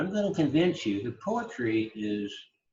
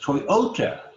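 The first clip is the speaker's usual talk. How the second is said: "Toyota" as toy-O-ta, the O punched; middle-syllable stress; 3 semitones higher, 4 dB louder than usual. Shown as a demonstration. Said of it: "That middle syllable is emphasized".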